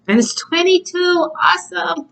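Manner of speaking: high delighted squeal at the number; excited squeal. Real, not acted. Tarot reader thrilled by the card's number, squealing high. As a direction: squeal with joy at the card.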